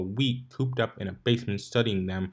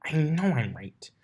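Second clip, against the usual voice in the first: unvoiced whisper, higher; whispering to herself; 6 semitones higher than usual. A narrator's reading. Twilight whispering to herself over a forbidden spell. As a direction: whisper it as a private doubt. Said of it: "began mumbling to herself".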